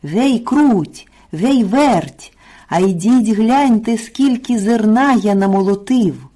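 high, lively rooster's holler; calling out. A narrator's reading. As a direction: summon them loudly from across the yard. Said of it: "та й знов гукає". (гукає, скликає)

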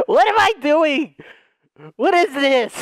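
high, incredulous outburst; exasperated disbelief. Acted. Joker baffled by his own antics, high exclaiming. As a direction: pitch the questions up in mock alarm.

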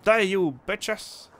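high, slow playful war cry; hammed-up gamer taunt; mock battle cry. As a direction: draw out a mock threat at the enemies.

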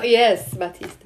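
ordinary talk, nothing marked

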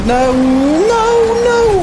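very high, loud wail of no; despairing high shout. Real, not acted. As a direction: watch the lead slip away and cry out. Losing the lead in race.

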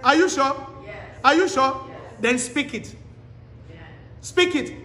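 usual speaking voice

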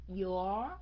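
"Your" with the O drawn out; long O. Shown as a demonstration. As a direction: stretch the vowel in "Your". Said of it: "âm O kéo dài". ("Your" kéo dài âm O)

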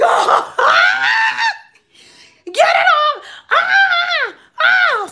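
real-sounding shrieks of disgust; panicked scream. Retold or imitated. Toenail on her lip.

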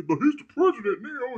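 high, loud mocking whine; hammed-up naive voter; mock whiny voter. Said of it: "Y'all really".

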